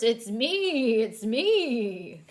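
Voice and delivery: lightly voiced horse. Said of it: "says the horse".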